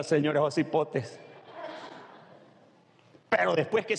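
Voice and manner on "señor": ordinary delivery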